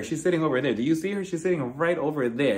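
ordinary talk, nothing marked

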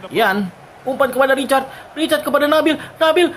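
rising excitement on a chance; excited call. Real, not acted. Chance near goal.

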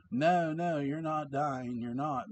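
urgent pleading reassurance; reassuring. Acted. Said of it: "hoping to reassure him".

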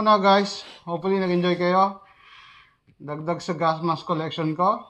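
muffled behind mask; speaking inside respirator; through a gas mask. Real, not acted. Seen 4: Full-face gas mask worn on face throughout labelled words.

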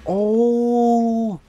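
long, high, loud "ooh"; amazed "oh". Real, not acted. Player sees the original map area, amazed. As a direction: hold a high, impressed "oh". Discovers original part of game.

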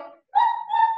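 playful high woof; high dog bark. Pretend. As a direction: bark like a tiny puppy. Imitating dog in song.

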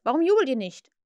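loud, peeved question; irritated. A narrator's reading. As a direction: demand it with irritation. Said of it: "rief er gereizt".

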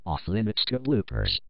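ordinary delivery

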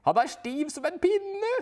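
high, loud scornful voice; wife jeering at the Swede; mocking wife. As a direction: poke fun. Wife mocking Swedish skier.

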